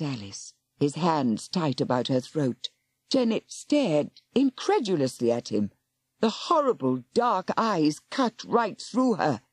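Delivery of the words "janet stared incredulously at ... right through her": ordinary delivery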